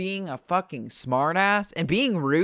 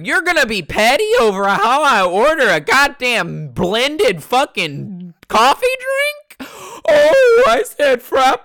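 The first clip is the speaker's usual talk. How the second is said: high, loud, slow outraged yelling; outraged rant; 12 semitones higher, 11 dB louder than usual. Pretend. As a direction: scream it at the drive-thru guy in disbelief. Imagined rant at the worker.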